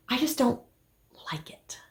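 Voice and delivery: drops to a whisper on like it; whispered admission. Real, not acted. Vlogger admitting to viewers she hates it, whispering. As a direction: let the confession fade to a breath. Confessing she dislikes sewing shirts.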